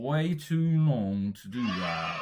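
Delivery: slow, emphatic British accent. Shown as a demonstration. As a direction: drag it out in British, like Ron Weasley. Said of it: "you sound like Ronald Weasley".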